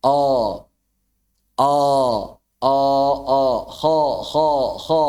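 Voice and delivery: open-mouthed heavy o, no pouting lips; open thick o. Shown as a demonstration. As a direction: say O with the mouth opened, not pushed forward. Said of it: "bukan dimonyongkan, tapi dibuka". (O tebal dengan mulut dibuka, bukan dimonyongkan)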